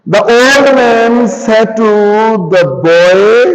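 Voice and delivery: slow, word-by-word dictation to a class; teacher dictating at writing speed. Shown as a demonstration. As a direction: spell out each word so the class can write it down. Teacher dictating a sentence slowly.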